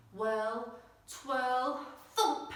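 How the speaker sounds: ordinary delivery, nothing marked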